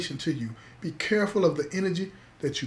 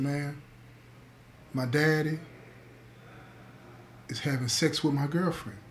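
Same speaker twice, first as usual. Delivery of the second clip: choked up; 7 dB quieter than usual. Retold or imitated. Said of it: "And he's choked up".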